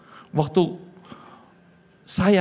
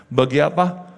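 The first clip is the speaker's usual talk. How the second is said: usual speaking voice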